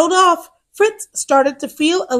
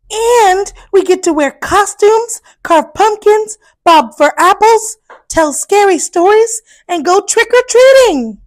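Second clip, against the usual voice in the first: higher, eager little hippo voice; eager Fiona; 5 semitones higher, 4 dB louder than usual. Acted. To baby brother Fitz, listing Halloween fun, excited. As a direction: list the fun things with glee. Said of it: "Fiona explained".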